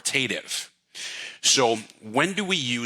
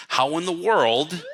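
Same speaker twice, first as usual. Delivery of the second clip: slow, rising higher on world; upswing; 7 semitones higher, slower than usual. Shown as a demonstration. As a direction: stretch world up high.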